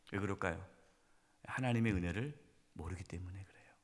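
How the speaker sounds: whisper. Real, not acted. Preacher drops to a whisper.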